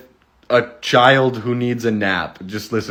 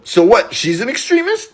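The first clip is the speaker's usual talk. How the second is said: mock incredulous; 10 semitones higher than usual. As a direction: pitch leaps way up on extremist, mock disbelief. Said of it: "Pierre quote continues".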